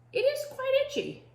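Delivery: high, proper little voice; prim rascal. A narrator's reading. Rascal answering the pirate captain, high prim voice.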